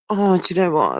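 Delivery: ordinary delivery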